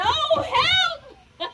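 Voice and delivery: high laughing mock shout; hammed-up horse crying help. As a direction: yell it out playfully in panic.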